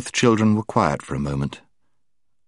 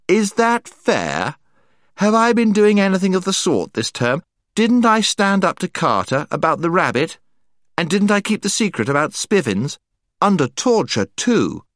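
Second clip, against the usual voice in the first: put-on protesting boy; 10 semitones higher than usual. Eustace protests, voiced higher.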